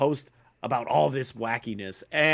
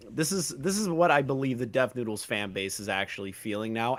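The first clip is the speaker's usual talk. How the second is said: ordinary talk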